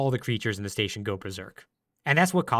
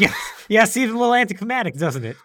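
laughing, higher and louder; 9 semitones higher, 7 dB louder than usual. Real, not acted. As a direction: laugh through the words. Laughing at abrupt ending.

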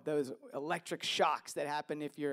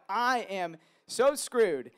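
mock panic; 5 semitones higher, 10 dB louder, much slower than usual. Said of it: "That's like a".

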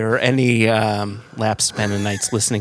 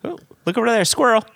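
much higher, sudden squeal of distraction; high distracted voice; 12 semitones higher, faster than usual. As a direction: point away like a distracted dog. Mock distraction joke.

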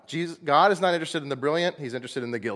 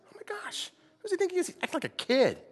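scandalized gossip; 4 dB quieter than usual. Pretend. Mocking judgmental onlooker.

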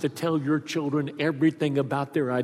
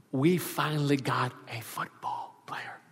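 breathy hushed confiding; whispered secret; 2 semitones lower, 10 dB quieter than usual. Retold or imitated. Father telling his son the secret, whispered. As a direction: tell it as a precious secret.

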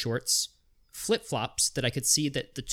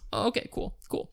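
mock polite brush-off; 8 semitones higher than usual. Polite brush-off reply to Tommy.

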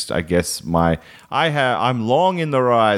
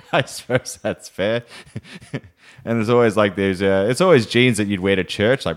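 ordinary delivery

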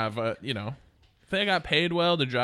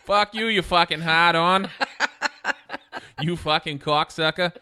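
a louder, mock-angry rant in a bad Boston accent; terrible Boston accent; 4 semitones higher, 5 dB louder than usual. Ribbing his cohost with insults in a bad Boston accent. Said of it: "I slipped into a Boston accent there".

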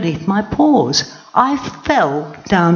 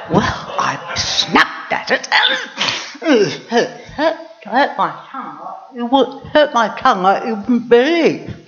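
laughing; 6 semitones higher than usual. Acted. Lioness recalls hurting her tongue.

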